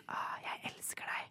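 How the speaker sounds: very soft, slow whispered declaration; lover's whisper. Acted. Imagined boyfriend declaring love to her, whispered. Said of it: "la oss si at jeg hadde blitt forelsket".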